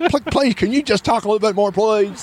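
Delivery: high, quick mock begging; imitating pleading guards. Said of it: "they said exactly the same".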